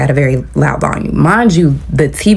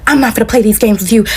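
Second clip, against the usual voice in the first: higher-pitched irritated rant, little added volume; mock yelling; 8 semitones higher than usual. Roommate accusing her of provoking on purpose, mock yelling. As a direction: imitate an accusing yell without really shouting. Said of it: "I hear her yelling".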